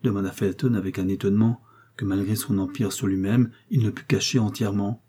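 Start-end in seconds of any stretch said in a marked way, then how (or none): none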